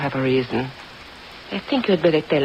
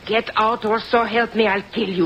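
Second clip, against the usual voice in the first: higher, seething threat to kill; cornered countess threatening the snooping detective, furious; 5 semitones higher than usual. Acted. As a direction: spit out the threat in rage.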